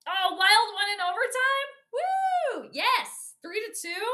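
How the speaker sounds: thrilled fan shout; delighted cheer. Real, not acted. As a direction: celebrate the overtime win out loud. Wild won in overtime.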